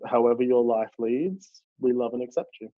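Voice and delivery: ordinary talk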